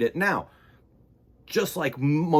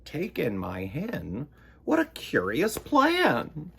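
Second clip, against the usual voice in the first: put-on puzzled old lady; 2 semitones higher than usual.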